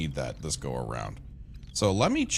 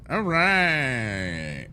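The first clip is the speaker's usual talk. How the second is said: perked-up all right; 5 semitones higher than usual. Real, not acted. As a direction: bounce "All right" up brightly as a restart. Louder, higher take right after.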